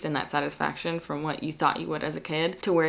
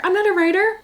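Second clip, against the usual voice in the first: even higher, louder mock squeal; mock self-doubt, louder; 14 semitones higher, 6 dB louder, faster than usual. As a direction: push the whine into a squeal. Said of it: "I'm like".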